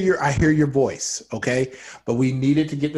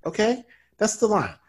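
ordinary talk, nothing marked

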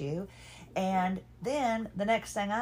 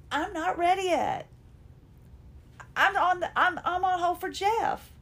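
pissy protest; 10 semitones higher than usual. Retold or imitated. Wife protesting to husband that she's on hold, pissy and high-pitched. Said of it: "then I'm getting pissy".

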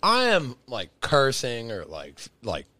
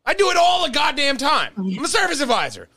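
exasperated raised voice; 4 dB louder, faster than usual. Real, not acted. Defending himself, raised voice.

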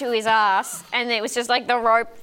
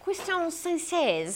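slow, higher, attempted French accent; 8 semitones higher, 4 dB quieter, slower than usual. Host reading a patron's name, attempted French accent. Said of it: "I was trying to do a French accent".

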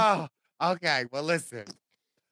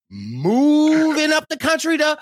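hype announcer; 9 semitones higher, 3 dB louder than usual. Acted. Hyping tour announcement.

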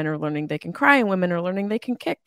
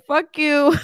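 defiant shout; 9 semitones higher, 7 dB louder than usual. Pretend. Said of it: "an edible that has to say".

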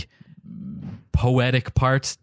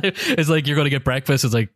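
ordinary talk

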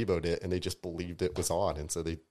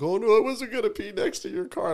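high, loud mock innocence; exaggerated high-pitched protest; protesting denial; 8 dB louder than usual. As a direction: put on a squealed excuse. Acts out drunk man's denial.

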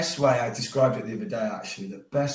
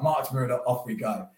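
ordinary talk

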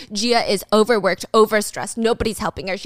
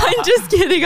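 much higher, louder, laughing through it; 9 semitones higher, 5 dB louder than usual. Real, not acted. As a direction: laugh off the joke.

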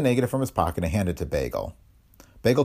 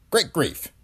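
Great grief; 9 semitones higher than usual. A narrator's reading. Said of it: "Blount said to the district attorney".

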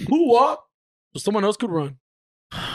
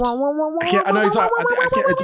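strained voice; 12 semitones higher than usual. Real, not acted. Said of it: "sound like a dead pigeon".

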